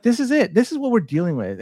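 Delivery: normal talking voice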